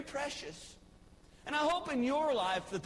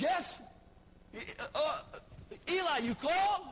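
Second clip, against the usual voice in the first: put-on eager young Samuel; 5 semitones higher than usual. Samuel runs to Eli again.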